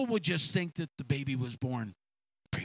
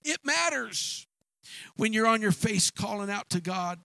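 preaching; 4 semitones higher than usual. Real, not acted. Preacher urging the congregation, emphatic and building. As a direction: build like a preacher driving home a point.

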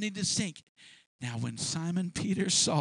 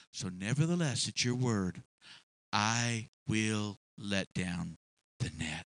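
quiet surrender; 8 semitones lower, 4 dB quieter than usual. Acted. Simon bowing to the Master, quiet surrender. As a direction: yield quietly to the Master's word. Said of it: "he said".

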